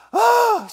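high, loud mock sobbing cry; imitated sobbing wail. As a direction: wail like a sobbing bride, hammed up. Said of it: "She's moaning and sobbing".

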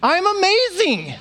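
high, smug self-praise. Retold or imitated. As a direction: preen like a show-off. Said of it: "He's like".

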